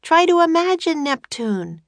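high, projected urging; Annie shouting. A narrator's reading. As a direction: keep calling it out in a high voice. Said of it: "shouted Annie".

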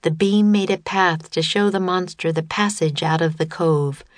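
usual speaking voice

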